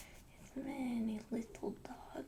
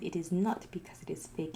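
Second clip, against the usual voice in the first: ordinary talk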